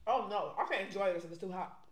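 ordinary talk, nothing marked

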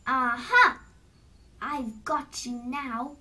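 loud aha, then slow gloating; pouncing troll. A narrator's reading. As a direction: burst out, then savour the catch. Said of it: "said the troll".

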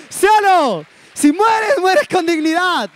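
high, loud rallying call; rousing shout. Real, not acted. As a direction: fire up the crowd. Rallying the audience with his motto.

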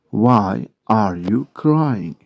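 "Why are you crying" drawn out slowly; slow model repeat. Shown as a demonstration. English teacher repeating a question for Turkish learners, slow model repeat. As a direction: separate each word for learners.

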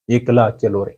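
ordinary talk, nothing marked

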